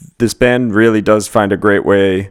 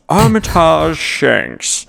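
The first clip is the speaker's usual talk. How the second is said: high, slow "Armitage Shanks" like a cartoon villain; 7 semitones higher, much slower than usual. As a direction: announce "Armitage Shanks" like a silly cartoon baddie. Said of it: "sounds like a ridiculous cartoon character".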